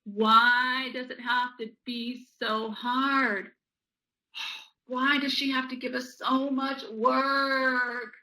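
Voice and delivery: slow, dragged-out mock moaning; whiny complaint.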